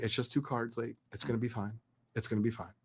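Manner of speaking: murmured pep talk to himself; quiet self-talk. Retold or imitated. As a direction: collector calming himself over missing cards, softly reassuring. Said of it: "I was talking to myself".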